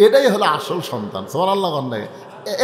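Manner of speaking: ordinary delivery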